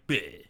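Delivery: low, soft, limp "bleh"; mock dying noise. Co-host mimics the ambushed woman going down, limp "bleh". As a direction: drop low into a feeble mock death. Imitating the victim collapsing.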